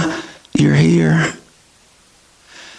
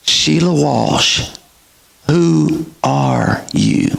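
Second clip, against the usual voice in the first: slow, searching question; gentle probing; 2 semitones higher, slower than usual. Retold or imitated. Doctor probing his patient, slow and gentle. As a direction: ask it slowly, looking her in the eyes.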